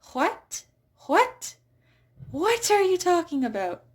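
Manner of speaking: incredulous dialect "what" with a hint of H; mock bewildered dialect speaker. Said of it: "a tiny little whisper of an H in some dialects".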